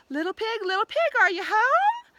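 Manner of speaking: high, loud call building up. A narrator's reading. Wolf calling at the brick house door, rising high and loud.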